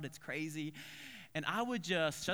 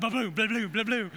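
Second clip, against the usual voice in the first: loud, higher bouncing burble; imitated tumbling noise; 6 semitones higher, 8 dB louder than usual. Kid who would not let go of leash, loud bumping noises. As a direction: blubber out each bump, loud and high.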